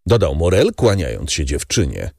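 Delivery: usual speaking voice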